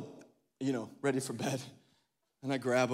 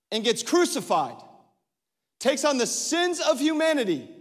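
high, loud, driving sermon voice; full-force preaching; 8 semitones higher, 7 dB louder than usual. Acted. Pastor urging the church to see God's goodness, preaching hard. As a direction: preach it out with rising fire.

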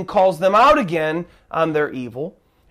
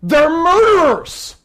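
high, loud pleading sermon voice; fervent preacher's cry; impassioned preaching; 9 semitones higher, 7 dB louder, slower than usual. Acted. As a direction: preach it out like a plea. Preaching at full force.

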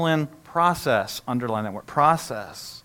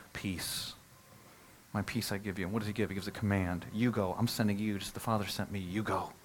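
soft tender voice; 6 semitones lower, 10 dB quieter than usual. Acted. Jesus' words voiced softly.

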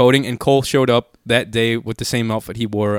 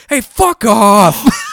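high, loud, drawn-out imitated shout; drunken yell; 11 semitones higher, 9 dB louder, much slower than usual. Drunk friend mistaking dad for Zach, hostile yell. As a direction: bellow it slowly like a drunk heckler.

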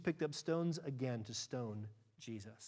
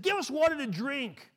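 demanding shout; 11 semitones higher, 11 dB louder than usual. Acted. People demanding water from Moses.

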